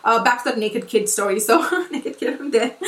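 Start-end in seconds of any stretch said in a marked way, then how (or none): 1.4-2.8 cracking up mid-sentence; real laugh breaking into the words. Real, not acted. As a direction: try to talk while laughing. Cracks up at naked kid story.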